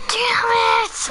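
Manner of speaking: frustrated yell. Real, not acted. Frustrated after in-game death.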